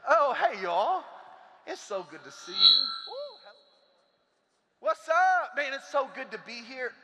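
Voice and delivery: high, loud, bright-faced hello; cheerful greeting. Acted. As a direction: breeze in greeting everyone cheerfully. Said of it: "walk into work like this".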